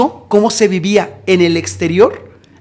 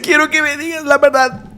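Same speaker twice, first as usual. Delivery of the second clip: high, subdued wailing plea; imitated tearful demand; 7 semitones higher, 4 dB quieter than usual. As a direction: act out a tearful captor. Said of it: "el corazón se le desgarraba".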